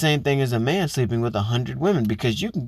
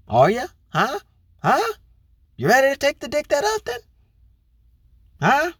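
taunting; 13 semitones higher than usual. Acted. Host taunting listeners to answer.

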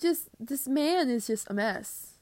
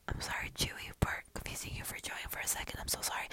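hushed, secretive whisper; 13 semitones lower, 9 dB quieter than usual. Pretend. To listeners, hiding from [name], a whisper. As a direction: murmur it so nobody overhears.

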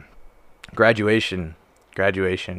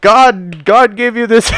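loud, high, hammed-up exhortation; preaching chaplain; 10 semitones higher, 8 dB louder, slower than usual. Chaplain urging recruits up the hill, mock fervor. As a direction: imitate a chaplain rallying with fervor. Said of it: "he's like".

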